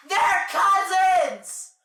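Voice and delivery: loud, high, slow shout; outraged shout. Real, not acted. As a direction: shout it in disgust. Shouted vs muttered.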